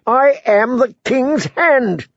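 slow, high outraged claim; indignant shout. A narrator's reading. Prisoner shouting at the departing guards, slow and high. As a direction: shout your rank at them. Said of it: "the prisoner shouted".

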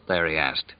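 usual speaking voice

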